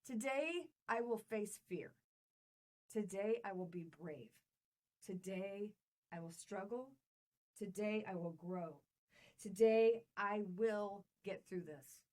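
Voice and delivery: measured group-mantra delivery; mantra recital. Shown as a demonstration. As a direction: declare each line like a vow. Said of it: "Are we ready".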